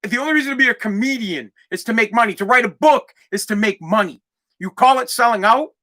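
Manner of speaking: usual speaking voice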